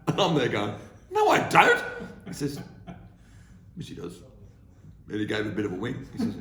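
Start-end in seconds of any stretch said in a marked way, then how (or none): 1.1-1.8 high, loud, squeaky denial; mock outraged young man, much higher and louder; indignant protest. 3.8-4.1 undercut him quietly; lightly voiced dry uncle, softer; deadpan reply.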